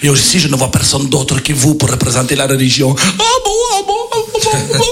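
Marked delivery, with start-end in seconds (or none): 3.0-4.8 much higher, breathless ah bon; squeaky flustered priest. Acted. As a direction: stammer it out, dazzled.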